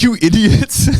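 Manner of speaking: imitating an exasperated listener; mock listener. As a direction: scoff like a fan at home. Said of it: "People at home are like".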